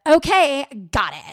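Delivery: loud, harsh bite on okay; venomous. Shown as a demonstration. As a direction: read it with venom. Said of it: "read a text like it has so much venom".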